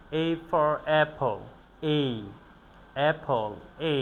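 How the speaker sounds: "A for apple" spoken very slowly, word by word; slow drill. Shown as a demonstration. Teacher drilling "A for apple" slowly for students. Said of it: "repeating the drill".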